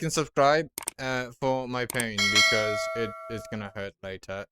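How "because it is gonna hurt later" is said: normal talking voice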